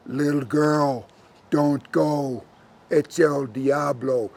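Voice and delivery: slow, low cow voice; cow character. Acted. Cow warning the girl of the devil, slow and low. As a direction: warn her low, stretching every word.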